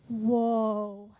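low, loud amazed whoa; awed whoa. Retold or imitated. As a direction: struck by the words. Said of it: "And I was like".

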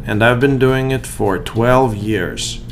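loud, upbeat sponsor read over music; polished podcast ad voice. Acted. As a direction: sell the law practice like a radio spot.

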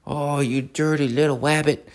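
hammed-up cartoon hunter cursing the rabbit; Elmer Fudd. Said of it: "I think it was Elmer Fudd".

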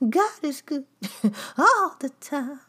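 high, laughing, hammed-up praise; mock ecstatic worshipper. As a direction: gush it with a laugh, overdone. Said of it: "telling people".

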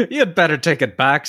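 high-pitched, heated stage-Irish; mock-angry Irish threat; angry Irish warning. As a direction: warn him in a caricature Irish brogue. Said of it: "now dark with passion".

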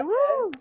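sudden high whoop; excited high squeal. Real, not acted.

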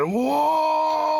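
long high held wail; imitated scream. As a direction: mimic an injured player screaming. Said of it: "el weón empezó a gritar". (grito imitado, agudo y sostenido)

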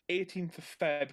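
usual speaking voice

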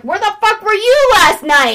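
high-pitched retold telling-off; hammed-up accusing question; mock scolding mom. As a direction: demand it like an indignant mother. Mom confronts her daughter.

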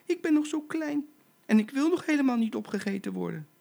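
tiny, very high pleading squeak; frightened, squeaky little animal begging; trembling little voice. Acted. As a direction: plead for your life as a little baby zebra. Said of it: "met een bibberend stemmetje".

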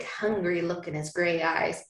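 normal talking voice

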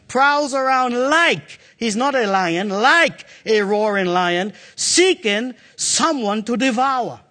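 drawn-out, weighty scripture reading; slow preaching. Acted. Preacher stretching the verse for the church, slow and weighty. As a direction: lean on every word.

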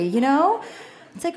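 usual speaking voice

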